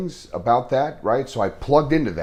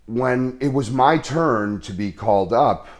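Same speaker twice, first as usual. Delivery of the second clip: ordinary talk, nothing marked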